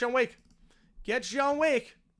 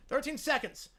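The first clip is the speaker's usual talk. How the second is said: loud, high, slow hyped touchdown call; play-by-play call; 4 semitones higher, 8 dB louder, slower than usual. Acted. Streamer calling the Evans touchdown for viewers, announcer hype.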